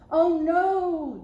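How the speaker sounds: high, loud gasp of fright; alarmed cry. Acted. Daddy Bear seeing a shadow, crying out in alarm. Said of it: "Daddy cried".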